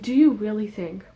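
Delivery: normal talking voice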